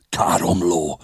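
usual speaking voice

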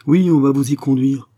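gruff commissioner cutting her short; gruff grumble. A narrator's reading. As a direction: growl it back, irritated and forceful. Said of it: "grogna le commissaire".

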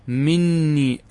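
"مني" said min-nii, the n held long; doubled nun. Shown as a demonstration. Teacher repeating the form that keeps the nun, "مني" with doubled n. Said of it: "هذا إذا أثبتت النون".

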